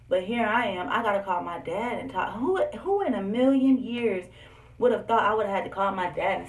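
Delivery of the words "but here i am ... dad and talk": usual speaking voice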